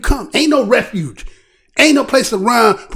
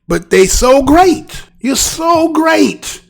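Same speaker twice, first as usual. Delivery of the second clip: sarcastic praise; 8 semitones higher, 3 dB louder, much slower than usual. Real, not acted.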